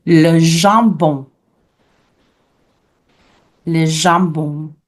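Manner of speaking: slow "le jambon", zham-BON; French pronunciation. Shown as a demonstration. Teacher reviews the word for ham, French pronunciation. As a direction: model French "jambon" drawn out for repeating. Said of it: "we learned ham, which is".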